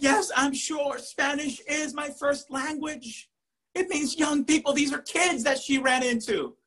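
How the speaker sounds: high-pitched, exasperated insistence; insistent retort. Retold or imitated. Student standing up to doubting professor, high insistent retort.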